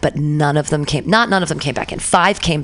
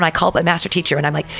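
normal talking voice

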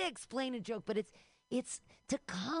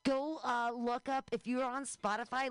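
ordinary talk

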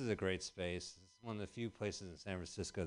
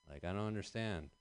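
usual speaking voice

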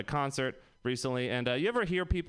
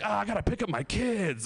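mock panic; 6 semitones higher than usual. Imagined middle-aged drug user panicking.

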